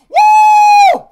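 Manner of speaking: long high shouted whoo; piercing, held cheer of excitement; fired-up whoop. Real, not acted. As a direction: let out a loud, high whoop. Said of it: "I'm super fired up".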